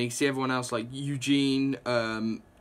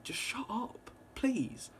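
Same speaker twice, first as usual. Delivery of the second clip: higher and much softer plea; exasperated hush; 9 semitones higher, 10 dB quieter, slower than usual. Retold or imitated. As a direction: hush them, tired of it. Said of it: "everybody's just like".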